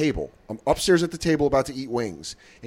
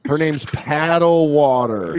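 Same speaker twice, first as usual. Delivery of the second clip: slow mock naming; much slower than usual. Joking slow fake names.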